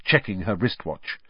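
usual speaking voice

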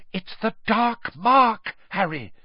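slow, frightened moan. A narrator's reading. Hermione moaning the warning to Harry, slow and frightened. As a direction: draw the words out in dread. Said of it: "Hermione moaned".

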